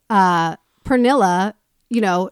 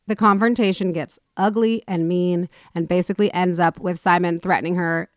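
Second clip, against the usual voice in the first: ordinary talk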